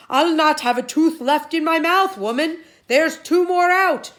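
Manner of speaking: very loud, high pained holler. Acted. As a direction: bellow over lost teeth. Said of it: "Cuchulainn hollered even louder".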